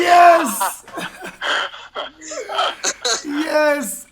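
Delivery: loud, high cheer; excited shout. Real, not acted. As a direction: shout yes with delight. Cheering at the punchline.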